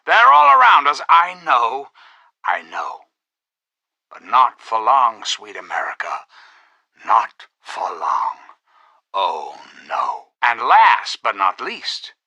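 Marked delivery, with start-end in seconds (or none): 0.1-1.9 high, loud theatrical warning; alarmed outcry. Acted. Orator warning that terror and murder surround us, alarmed outcry. 2.4-10.2 low, hushed promise after the alarm; soothing reassurance. Acted. Leader promising sweet America it won't be for long, soothing reassurance.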